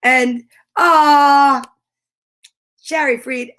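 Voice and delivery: ordinary talk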